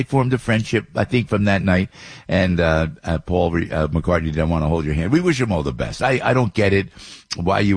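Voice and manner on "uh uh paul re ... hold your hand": ordinary delivery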